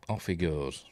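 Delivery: normal talking voice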